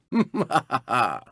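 low chuckle swelling into whooping laughter; gloating laugh. Acted. Racer gloating over rivals, long hammed-up laugh. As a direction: let a villainous, hooting laugh roll out.

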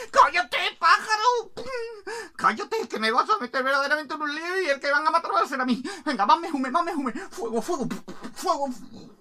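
high, loud, frantic scolding; panicked cook. Acted. Cook fears being killed over the bird, frantic high shouting.

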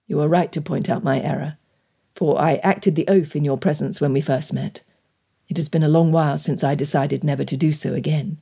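low, measured apology; contrite gentleman suitor. Acted. Gentleman admitting his error to her, contrite.

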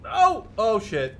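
high, loud yelp of alarm. Real, not acted. Gamer watching his plane crash, alarmed and loud. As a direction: cry out as the ground rushes up.

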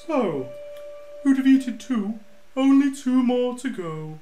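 lightly voiced slow giant. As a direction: drawl it like a bored giant. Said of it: "sounds like he's slow".

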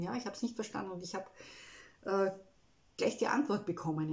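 normal talking voice

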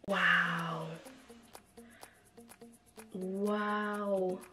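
ordinary delivery

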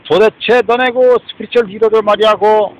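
loud, heated accusation; angry shout. Real, not acted. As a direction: bark it at them in anger. Angry rant at listeners.

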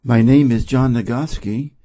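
usual speaking voice

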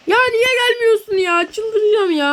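high, strained complaint on the edge of tears; exasperated whine. Real, not acted. Fed-up shopkeeper whining at empty store. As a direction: moan that you're losing your mind. Frustrated, no customers come.

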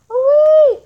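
high startled cry; shocked shriek. Real, not acted. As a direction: yelp in surprise. Sudden shock in game.

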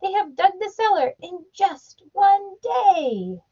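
high, excited crowd voice; cheering crowd. A narrator's reading. The people cheering Mike Mulligan, high excited voice. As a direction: cheer for the digger. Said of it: "shouted the people".

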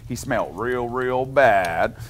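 usual speaking voice

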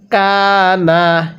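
slow recitation of ফামান কানা with a held nasal; Quran recitation. Shown as a demonstration. Teacher leads students through the verse, slow chant. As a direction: stretch ফামান কানা out slowly. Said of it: "তাহলে".

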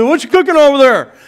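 imitated neighbor shouting over; high, loud comic holler; Louie. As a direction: yell it across to next door. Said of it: "He said".

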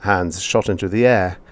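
usual speaking voice

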